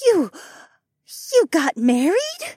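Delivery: high, halting disbelief; falter, then ask in a high voice; shocked mother. A narrator's reading. Mother learning her son has married, shocked.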